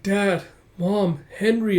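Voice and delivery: high plaintive cry; plaintive calling. Acted. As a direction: call out weakly high for family. Wounded soldier calls for family.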